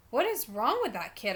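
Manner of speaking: usual speaking voice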